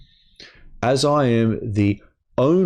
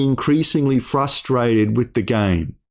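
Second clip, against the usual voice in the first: usual speaking voice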